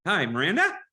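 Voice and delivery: "Miranda" swooping up like a question, high and loud; up-itis. Shown as a demonstration. Colleague mocking the calling habit, exaggerated upward lilt on "Miranda". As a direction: hail her with an exaggerated rising ending. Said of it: "not upitis or whatever you're calling it".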